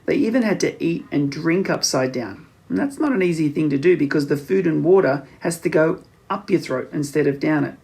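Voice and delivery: plain reading voice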